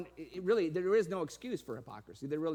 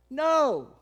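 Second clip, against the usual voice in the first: emphatic no; 7 semitones higher, 12 dB louder than usual. Retold or imitated. Scoffing answer in sermon.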